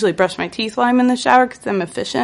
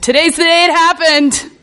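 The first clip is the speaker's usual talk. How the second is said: high mock yell; hammed-up cry of realization; retold yell; 6 semitones higher than usual. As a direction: proclaim it in a raised, high voice. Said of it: "I just yelled".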